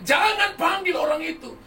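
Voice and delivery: high, loud possessed yell; demon's scream. Retold or imitated. Possessed girl cries out before seeing the pastor, yelling. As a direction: yell the warning as the evil spirit. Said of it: "Dia udah teriak".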